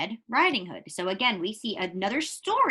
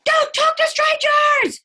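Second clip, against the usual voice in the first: high, loud, slow chicken shout; shrill little chicken hollering a warning; 10 dB louder, slower than usual. Acted.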